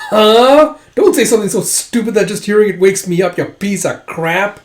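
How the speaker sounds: loud, high outraged outburst; indignant retold rant; acted angry retort. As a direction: explode at a stupid remark. Huck snaps at insulting question.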